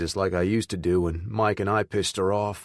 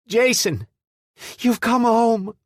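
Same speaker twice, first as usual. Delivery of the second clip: high, loud joyful cry; yelling; 7 dB louder, slower than usual. A narrator's reading. Mother running to her returning son, yelling with joy. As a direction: shout his name as she runs to him.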